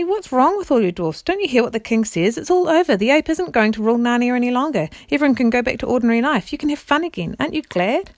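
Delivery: put-on high, loud, exasperated girl; impatient. A narrator's reading. Jill telling all the dwarfs it's over, impatiently. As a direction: urge them on in a high, impatient voice. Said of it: "said Jill impatiently".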